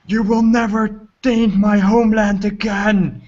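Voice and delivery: high, impassioned battle declaration; heroic vow. Acted. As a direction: proclaim it at your enemy mid-strike. Hero's vow as he strikes dragon.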